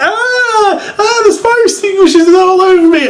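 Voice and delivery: very high laughing mock wail; mock Kane wailing. Vlogger imitates foamed wrestler, high laughing mock wail. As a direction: whine it through laughter, hammed up. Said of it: "and he's like".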